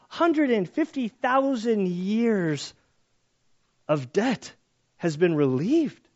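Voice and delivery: slow, stretched and high; emphatic awe. Real, not acted. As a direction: drag out the huge number in awe. Stunned emphasis on huge debt.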